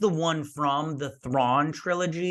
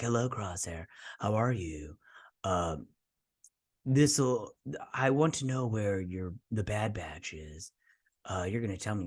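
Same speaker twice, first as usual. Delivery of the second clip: quieter, lower sinister croon; creepy doctor; 3 semitones lower, 7 dB quieter than usual. Retold or imitated. Doctor questioning Crosshair about the Bad Batch, soft and sinister. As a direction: lean in like a creepy interrogator. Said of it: "This guy creeps me out".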